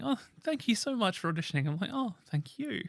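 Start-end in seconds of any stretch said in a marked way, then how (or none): 0.0-1.7 softly mimicked polite letter, higher pitch; lightly voiced polite formality. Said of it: "a very polite letter". 1.9-2.8 small, high, soft thank-you; reply politely in a higher, softer voice; lightly acted meek thanks. Acted reply to polite audition letter.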